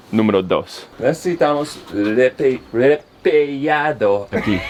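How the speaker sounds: Spanish with a gringo accent; slow, careful Spanish, American accent. Real, not acted. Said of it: "I talk like a gringo".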